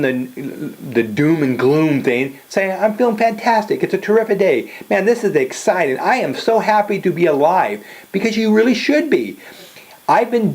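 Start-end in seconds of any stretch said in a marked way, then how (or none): 2.7-7.8 higher, eager positive reply; upbeat enthusiasm. Shown as a demonstration. Coach models answering how's your day, upbeat and enthusiastic. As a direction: answer brightly, pitched up with enthusiasm.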